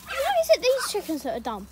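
loud, fed-up outburst; exasperated yell at the chickens. Real, not acted.

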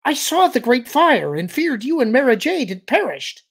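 high-pitched, loud alien voice; excited relief. A narrator's reading. Alien who feared them dead, high excited relief. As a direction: pour out the relief loudly, way up high. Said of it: "framed by excitement and relief".

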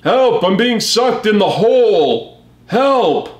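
high, slow, hammed-up cry for help; cartoon Autobot pulled into the hole; mock shouts for help. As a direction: wail it like a robot being sucked in. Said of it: "he's shouting".

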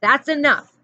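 loud, high yell of "enough". A narrator's reading. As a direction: stop the fight with a loud yell. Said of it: "Mister Pendanski yelled".

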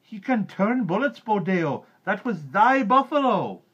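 high, very loud accusing yell; high-pitched mob outcry; villagers shouting. A narrator's reading. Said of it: "shouted the villagers".

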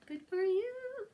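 plain reading voice

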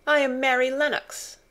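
put-on offended little girl announcing herself; stiff and proud. Mary answering the rude officer, stiffly proud. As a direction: draw yourself up and state it. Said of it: "drawing herself up stiffly".

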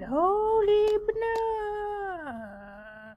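very drawn-out high wail; whining. Real, not acted. Player despairs at Sitaro not sitting down, whining wail. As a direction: wail it high and slow, sliding down. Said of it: "I'm the one whining".

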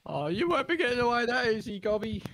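taunting hero voice. Acted. Taunting fleeing goblin in character.

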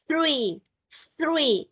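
THREE with tongue tip between the teeth; THREE repeated, each begun with an airy TH. Shown as a demonstration. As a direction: let cool air out on the TH of THREE. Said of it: "la puntita de la lengua va entre los dientes".

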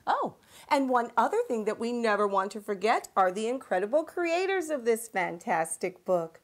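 ordinary delivery

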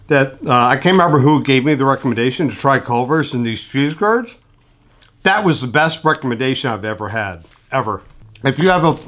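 speaking between bites; talking while chewing. Real, not acted. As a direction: mouth partly full of food. Seen 3: Curd held throughout; hand brings curd to mouth at 3.4–3.9 mid-speech.

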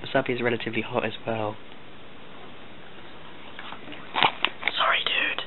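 hushed conspiratorial whisper. Real, not acted. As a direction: whisper so the victim can't hear. Whispering during the prank.

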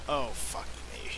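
ordinary talk

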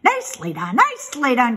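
loud goose cheer; cried-out praise; goose crying praise. A narrator's reading. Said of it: "cried the goose".

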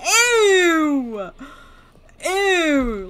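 loud, high squeal of disgust. Real, not acted. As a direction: squeal in revulsion, drawing it out. Disgusted reaction to the game.

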